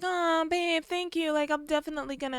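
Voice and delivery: high, soft mock-smitten voice; hammed-up lovestruck girlfriend; gushing gratitude. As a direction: coo sweetly up high, softly. Replaying Arabella's sappy thanks.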